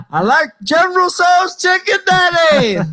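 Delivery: very high, hammed-up cry; cartoonish plea to a father; mock wailing son. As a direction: wail it like an overgrown child. Acted son wails at father.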